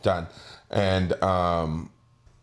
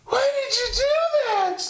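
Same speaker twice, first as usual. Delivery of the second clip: imitating crying sister. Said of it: "and she's like".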